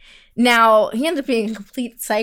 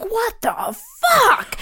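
very slow, much higher stunned line; stunned disbelief; 11 semitones higher, much slower than usual. Retold or imitated. Retelling her reaction to the bartender, slow and high. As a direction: stretch it out in shock. Retold own shock.

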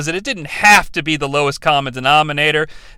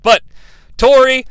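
usual speaking voice

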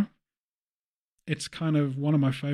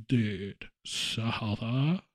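slow, low Valley dude drawl; lazy, low surfer dude; Valley guy voice; 5 semitones lower, 5 dB quieter, much slower than usual. Retold or imitated. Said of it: "That guy, the Valley guy".

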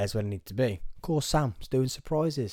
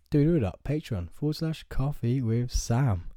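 ordinary talk, nothing marked

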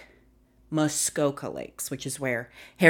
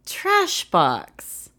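mock intro voice; 8 semitones higher, 4 dB louder, much slower than usual. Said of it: "That can't be how I usually say the intro".

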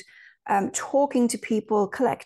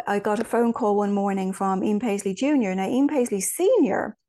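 ordinary talk

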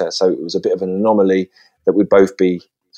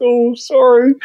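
sheepish apology; 14 semitones higher, slower than usual. Retold or imitated. Scolded by teachers.

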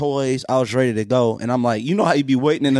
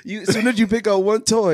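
usual speaking voice

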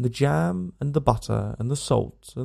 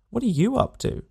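high, rising echo with the stress on are; "are" leaned on in a sarcastic echo; mocking retort; 9 semitones higher than usual. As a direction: throw his words back at him. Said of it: "he retorts".

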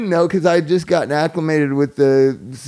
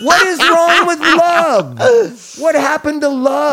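higher and slower, hammed-up preacher pleading; mock impassioned plea; 9 semitones higher, 3 dB louder, slower than usual. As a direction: overplay a tearful plea for love. Mock impassioned plea about love.

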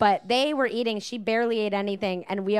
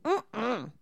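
put-on pouty toddler refusal; 2 semitones higher, 3 dB quieter than usual. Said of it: "kids where they go".

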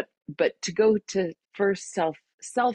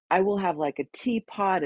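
ordinary talk

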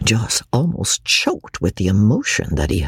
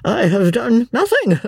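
flustered, pleased protest; a flurry of pleasure and confusion; lightly flustered and delighted; 10 semitones higher than usual. A narrator's reading. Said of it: "in a flurry of pleasure and perplexity".

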